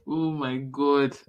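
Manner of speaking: ordinary talk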